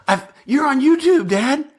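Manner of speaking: put-on high-pitched excited kid's exclamation; imitating excited son. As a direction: voice jumps way up, amazed at Dad. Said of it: "So he's super excited".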